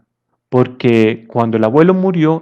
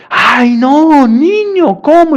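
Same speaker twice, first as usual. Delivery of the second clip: high, louder shocked cry of a mother; startled mother; 13 semitones higher, 5 dB louder, much slower than usual. Acted. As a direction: gasp it out, scandalized mom. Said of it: "la mamá se asusta y le dice". (madre asustada, voz muy aguda y fuerte)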